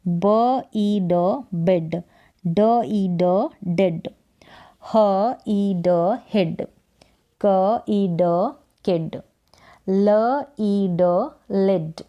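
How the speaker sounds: slow phonics, each sound alone, then "बिड", "डिड", "हिड" blended; sounding out letters. Shown as a demonstration. Teacher modelling practice for beginners, "ब ई ड" slowly sounded out, then "बिड". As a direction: draw out "ब ई ड" one sound at a time, then "बिड". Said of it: "ऐसे ही आपको pronounce करना है".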